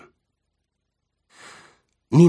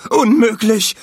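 loud, high-pitched wail of disbelief; panicked viceroy's wail; howling; 13 semitones higher, 8 dB louder than usual. A narrator's reading. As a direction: howl it out in panic. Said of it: "heulte der Vizekönig".

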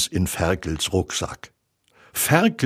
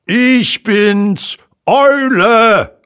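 loud, high owl voice; high, ringing storybook owl; owl character; 12 semitones higher, 8 dB louder, much slower than usual. A narrator's reading. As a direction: announce yourself grandly from the treetop. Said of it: "sagte die Stimme".

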